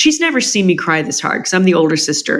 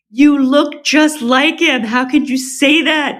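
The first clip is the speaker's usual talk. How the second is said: tearful insistence; 8 semitones higher than usual. Retold or imitated. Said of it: "managed to stop crying long enough to say".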